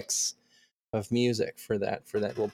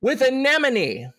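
big drawn-out announcer read; hammed-up hype voice for the credits; announcer shout-outs; 5 semitones higher than usual. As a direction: present each name like a ring announcer. Reads out patron shout-outs.

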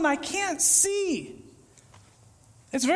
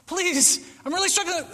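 desperate plea; 7 semitones higher than usual. Retold or imitated. Voicing the struggling believer.

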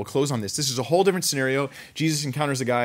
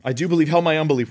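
loud plea; 5 semitones higher, 6 dB louder, faster than usual. Acted. Repeats father's cry.

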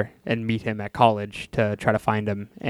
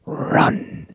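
whispered clown; 7 semitones higher than usual. Retold or imitated. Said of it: "and he goes".

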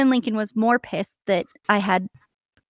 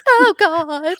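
mock scared dog; 6 semitones higher, 5 dB louder than usual. Penny's like.

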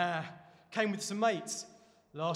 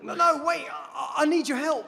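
much higher and louder, pleading; urgent plea; 10 semitones higher, 13 dB louder than usual. Acted. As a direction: call her back before she leaves. Pleading not to be left.

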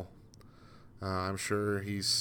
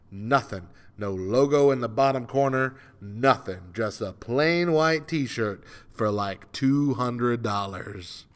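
ordinary talk

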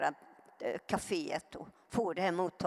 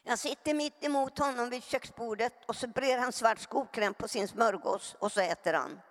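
usual speaking voice

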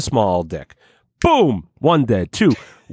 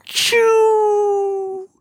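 very high cartoon squeak; squeaky cartoon chew. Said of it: "he's going".

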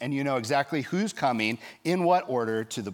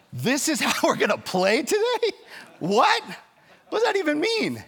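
much higher, louder, stunned disbelief; incredulous outrage; 13 semitones higher, 4 dB louder than usual. Retold or imitated. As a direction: repeat the ruling back in outraged disbelief. Coach's incredulous reaction to ruling.

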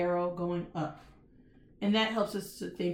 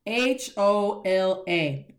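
letters spelled out slowly; slow spelling; much slower than usual. Shown as a demonstration. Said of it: "write hola".

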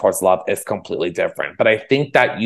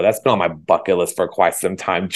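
ordinary talk

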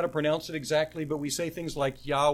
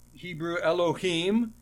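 ordinary talk

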